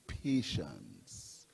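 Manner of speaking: low, hushed, drawn-out word; calming. Real, not acted. Party speaker asks impatient supporters for patience, calming hush. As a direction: drop right down and settle the crowd. Counsels patience after mimicked complaints.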